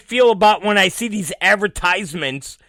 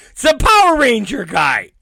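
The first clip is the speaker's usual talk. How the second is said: normal talking voice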